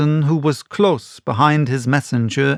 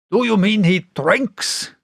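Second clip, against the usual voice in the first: higher, alarmed question; startled squire; 8 semitones higher than usual. A narrator's reading. Squire startled to hear of the mate drinking, higher. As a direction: catch the shock. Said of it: "cried the squire".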